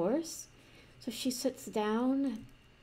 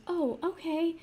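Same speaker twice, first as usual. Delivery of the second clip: a lightly higher, surprised little "oh, okay"; princess voice; 8 semitones higher, 3 dB louder than usual. Acted. Princess accepting the cheap price, lightly higher voice. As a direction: react like a pleasantly caught-off-guard princess.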